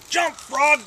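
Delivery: high, loud order to a frog; shouted command. Acted. Boy orders the one-legged-short frog to jump, shouted command. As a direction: yell it as the boy running his test.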